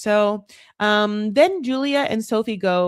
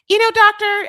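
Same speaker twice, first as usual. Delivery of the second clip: put-on high, loud stab at an odd accent; badly faked accent, pitched way up; Julia's accent, attempted; 13 semitones higher, 6 dB louder than usual. Imitating Julia addressing the doctor, high attempted accent. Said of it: "I can't do her weird ass accent".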